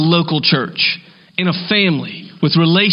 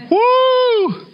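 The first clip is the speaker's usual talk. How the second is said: high joking whoop; excited whoop. Real, not acted. Preacher whoops at daughter's pricey order, excited high. Reacting to filet mignon order.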